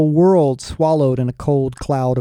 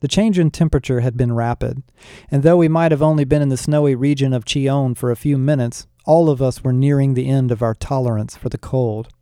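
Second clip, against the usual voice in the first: ordinary delivery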